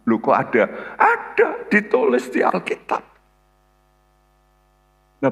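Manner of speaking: high, forceful preacher's delivery; emphatic preaching. Real, not acted. Preacher insisting to congregation that it is written, emphatic preaching. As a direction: preach it with sudden high-pitched bursts.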